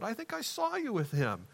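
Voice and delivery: put-on high, small girl's voice; lightly acted child, pitched well up; little girl. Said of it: "just a little girl came".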